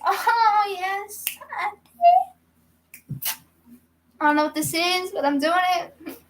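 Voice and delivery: high, slow put-on auntie voice, cracking at the end; mock auntie voice. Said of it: "I could be a good auntie".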